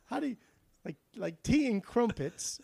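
put-on a clumsy posh English accent that slips into Australian; mock English accent, high and breathy; bad Australian-English accent. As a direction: put on a failed Queen's English voice. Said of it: "really bad Australian".

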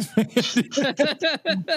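high giggling burst; helpless high-pitched laugh. Real, not acted. Laughs at the name Fort Dix.

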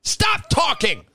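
high, loud mock tirade at himself; mock self-yelling. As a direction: yell at yourself like a fed-up critic. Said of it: "I'm yelling at myself".

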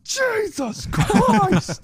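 high drawn-out shout. Acted.

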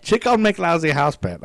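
high, loud attempt at Scottish; badly faked Scottish accent. Trying out an impression for a friend, failed Scottish accent. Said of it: "I don't, can't do Scottish".

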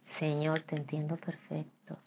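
soft, slow, low-key confiding; hushed mock prayer; resigned aside to God. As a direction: say it softly, slowly, as if conceding privately to God. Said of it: "le dije al Señor".

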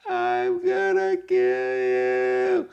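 very high, drawn-out spooky wail; eerie falsetto ghost moan. Acted. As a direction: wail it slowly like a haunting ghost. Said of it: "sale la voz de la fantasma Jenny".

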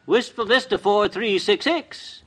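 slow, drawn-out number for the caller; businesslike phone answer. Acted. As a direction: answer importantly, stretching each word.

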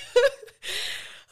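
high teary laugh through tears; laughing while starting to cry. Real, not acted. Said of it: "why am I getting emotional?".

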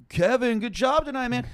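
mock fan voice. Imitating fans hanging around.

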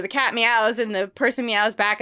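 usual speaking voice

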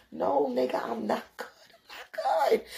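whimpering I'm not good, on the edge of tears; panicked near-cry. Retold or imitated. Telling the oblivious date she is not okay, panicked near-cry. Begging to leave roach apartment.

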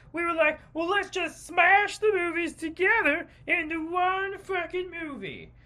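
high, hammed-up airhead producer voice; clueless producer. Suicide Squad producer explaining the edit, hammed-up high voice. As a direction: play him breezy and oblivious. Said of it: "from its producer".

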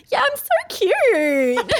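high, slow, laughing imitation of her sister gushing; mock self-admiring. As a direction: gush over yourself, hammed-up. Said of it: "She's like".

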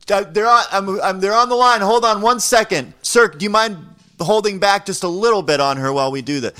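high, loud and flustered; hammed-up frantic plea to the attacker; panicky. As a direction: babble like a rattled caller who is also bargaining. Panicked retold phone call.

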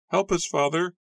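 put-on very high, pleading girl's voice; shrill anguished cry. A narrator's reading. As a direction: beg your father for help, shrilly. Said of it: "cried shrilly, her face also twisted in anguish".

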